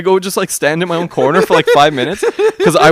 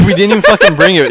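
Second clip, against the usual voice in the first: ordinary talk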